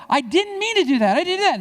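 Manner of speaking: put-on high, loud kid denying it; a kid insisting he didn't do it, high and whiny; whiny child protest. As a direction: squeal it like a caught kid.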